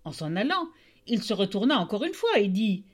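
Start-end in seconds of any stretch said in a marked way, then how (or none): none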